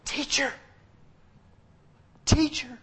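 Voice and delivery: Teacher called out high with joy; joyful cry. Retold or imitated. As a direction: cry out the name, overjoyed. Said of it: "she yells".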